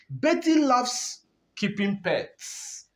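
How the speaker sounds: slow, high reading with weight on "loves"; "loves" held up high before an easing "keeping pets"; stress on loves. Shown as a demonstration. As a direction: read it out slowly, leaning on "loves". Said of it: "So emphasis is on loves".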